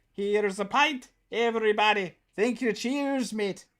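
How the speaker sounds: high-pitched mock-Irish pub cheer; playful stage-Irish thanks; Irish accent. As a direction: toast the room in a hammed-up Irish accent. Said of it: "can I say it in an Irish accent?".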